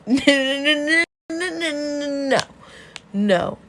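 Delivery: high, loud string of no's; panicked mock denial. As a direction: deny it in an exaggerated high yelp. Flustered denial in retold dialogue.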